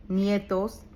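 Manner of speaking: usual speaking voice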